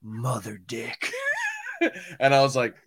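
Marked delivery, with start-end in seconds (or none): 0.0-1.1 slow, hushed, drawn-out delivery; Michael Cudlitz line impression. Co-host re-enacts actor's TV line, hushed and slow. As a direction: say it very softly and slowly. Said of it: "he just, he just goes".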